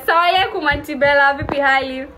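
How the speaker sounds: ordinary delivery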